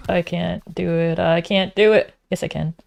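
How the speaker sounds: normal talking voice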